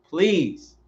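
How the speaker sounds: usual speaking voice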